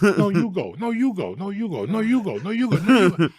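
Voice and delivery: high, polite back-and-forth yielding voices; mock timid drivers. Mocking scared California drivers politely yielding.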